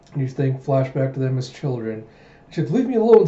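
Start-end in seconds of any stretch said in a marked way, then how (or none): 2.7-3.3 high, loud acted cry of protest; upset outburst. Child princess telling her cousin to go away, upset. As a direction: push him away with a raised voice.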